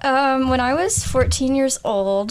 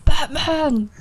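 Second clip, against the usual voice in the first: put-on British actor voice; Michael Caine; 2 semitones higher than usual. Guest impersonating the actor on request, hammed-up British voice. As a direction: call it out in an impersonated English accent. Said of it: "I think it's Michael Caine".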